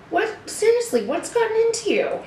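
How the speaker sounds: surprised. Acted. Puppet's rudeness shocks the pastor.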